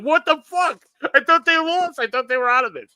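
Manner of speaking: high-pitched incredulous outburst; imitating baffled, high-pitched shock; stunned disbelief. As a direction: act out a friend's shocked reaction, voice shooting up. Friend's stunned reaction, acted out.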